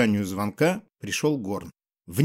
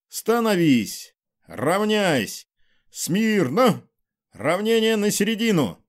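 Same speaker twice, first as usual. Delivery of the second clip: shouting drill sergeant; 7 semitones higher, slower than usual. A narrator's reading. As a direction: bark the parade orders high. Drill commands on parade.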